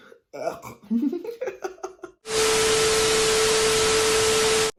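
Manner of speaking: a big airy burst of laughter, rising; loud laugh. Real, not acted. Laughing at her own excuse for sticking with maths, loud laugh.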